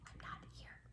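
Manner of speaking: tiny hiding whisper. Acted. Dog hiding in the corner, whispered. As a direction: hide and breathe it.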